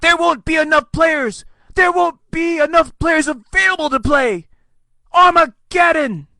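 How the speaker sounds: very high hysterical doomsayer; mock panic. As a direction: wail it like the world is ending, hammed up. Mock panic at week seventeen.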